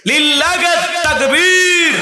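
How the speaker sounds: rally the crowd with a long call; calling out takbir. Real, not acted. Preacher calls crowd to takbir.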